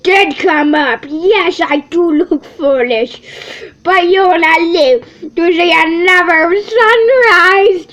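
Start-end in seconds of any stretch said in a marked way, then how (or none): 3.8-7.9 louder, higher threat; loud bear threat; menacing threat. Acted.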